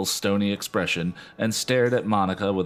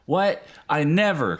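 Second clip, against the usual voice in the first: horrified cry; 8 semitones higher, 4 dB louder than usual. Acted. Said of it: "cried Monica, horrified".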